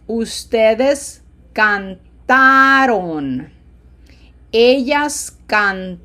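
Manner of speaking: slow drill, TÓ in "cantó" and TA in "cantamos", "cantaron" pushed long and full. Shown as a demonstration. Teacher drilling verbs for repetition, slow with heavily stressed syllables. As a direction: punch and stretch the TA of "cantaron". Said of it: "put more lung into the highlighted".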